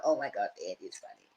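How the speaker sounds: quiet inner thought; soft aside. Retold or imitated. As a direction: murmur it as a private thought. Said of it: "she thought".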